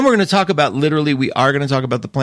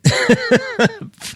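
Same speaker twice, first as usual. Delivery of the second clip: a high burst of giggling; high giggle. Real, not acted. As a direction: giggle at the joke. Laughing at own joke.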